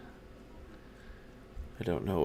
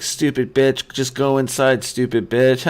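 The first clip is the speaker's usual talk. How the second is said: mock angry insult; 6 semitones higher, 7 dB louder than usual. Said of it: "my wife's like, "No, you were like".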